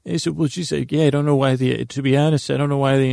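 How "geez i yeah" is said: ordinary talk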